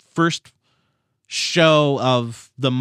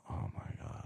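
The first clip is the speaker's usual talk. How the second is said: whispered groan; 21 dB quieter than usual. Acted. Whined despair at book.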